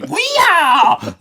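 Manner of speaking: high, loud laughing hillbilly whoop. Retold or imitated. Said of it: "he had that dumb accent".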